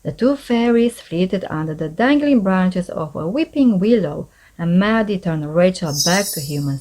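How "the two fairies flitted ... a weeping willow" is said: ordinary delivery, nothing marked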